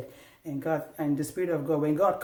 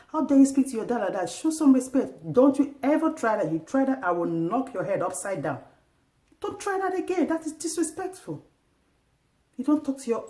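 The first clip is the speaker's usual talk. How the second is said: scolding mother; 7 semitones higher than usual. Retold or imitated. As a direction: tell the boy off sharply. Mother scolding her son.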